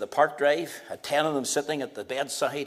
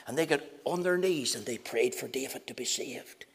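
whispered; 4 semitones lower, 6 dB quieter than usual. Real, not acted. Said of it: "whisper heard".

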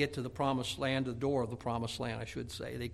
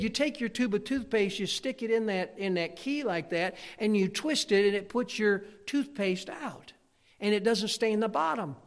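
put-on cocky kid; 7 semitones higher than usual. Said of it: "kind of cocky kid".

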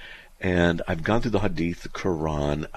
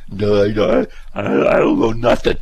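mock dumb caller; 2 semitones higher, 9 dB louder than usual. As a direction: play the clueless caller proudly admitting it. Acting a caller facing the screener.

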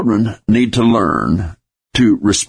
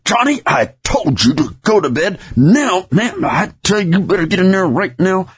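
angry parent; 10 semitones higher than usual. Retold or imitated. Parent fed up, shouting at Johnny to go to bed, furious high yell. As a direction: lose your temper and yell that bedtime is now. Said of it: "You're mad. Your teeth are clenched".

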